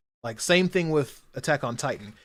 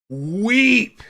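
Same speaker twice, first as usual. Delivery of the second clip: much louder and higher, belted out; emphatic cry; 9 semitones higher, 9 dB louder than usual. Shown as a demonstration. Repeating to viewers that it made her weep, loud and emphatic. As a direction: shout the word for emphasis.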